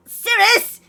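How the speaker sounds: high, loud, tearful apology; a panicked little boy blurting sorry; loud, high child on the edge of tears; flustered kid. Acted. Father scolds, son apologises.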